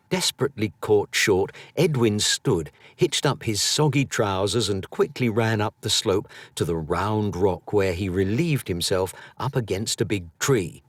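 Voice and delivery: plain reading voice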